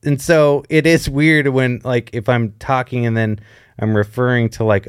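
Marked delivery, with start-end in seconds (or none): none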